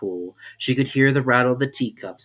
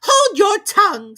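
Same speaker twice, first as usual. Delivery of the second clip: very high, loud, slow furious order; enraged Queen; 11 dB louder, slower than usual. A narrator's reading. The Queen to Alice, slow furious shriek. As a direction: purple with rage. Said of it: "said the queen, turning purple".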